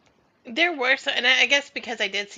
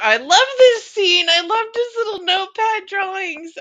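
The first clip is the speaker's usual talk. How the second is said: excited gush; 11 semitones higher, 8 dB louder than usual. Real, not acted. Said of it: "Oh, that was my favorite".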